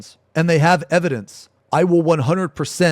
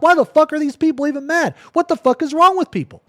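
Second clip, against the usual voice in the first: exasperated outburst; 12 semitones higher, 5 dB louder, faster than usual. Retold or imitated. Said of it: "I'm like".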